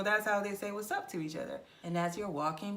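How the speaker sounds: usual speaking voice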